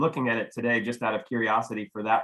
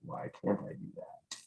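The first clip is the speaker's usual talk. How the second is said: muttering to himself; 14 dB quieter than usual. Real, not acted.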